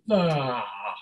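low groan from the gut; lowest register. Shown as a demonstration. Teacher demonstrating her lowest placement, deep gut voice. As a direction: drop the sound deep into the gut. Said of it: "That's the lowest one".

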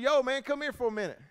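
high re-enacted holler; calling out. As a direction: call a man over from a distance. Re-enacts calling card players over.

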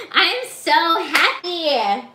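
loud, slow, drawn-out joy; gleeful. Real, not acted. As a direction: stretch it out in delight. Joy after finishing stitch.

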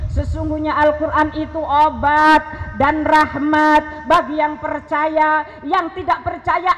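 loud, impassioned sermon voice; fervent preaching. Acted. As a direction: preach it out with full force. Preaching with rising fervour. (ceramah berapi-api dengan suara lantang)

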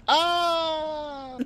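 high sudden death yelp; human death cry. Retold or imitated. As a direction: cry out like a dying creature. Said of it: "They sound like humans when you kill them".

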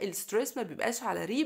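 ordinary talk

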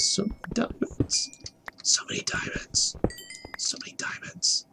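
whispering in awe; awed whisper. Real, not acted. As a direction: breathe it out, spellbound by treasure. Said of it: "I got seriously distracted by diamonds".

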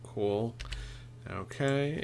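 normal talking voice

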